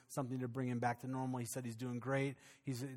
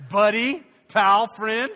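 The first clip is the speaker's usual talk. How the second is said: laughing; 8 semitones higher, 19 dB louder, much slower than usual. Retold or imitated. Fighting the fish, laughing.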